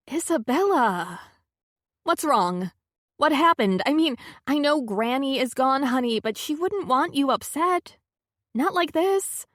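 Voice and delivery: pleading, alarmed and caring; worried friend voice. A narrator's reading. Carlotta's worried voice.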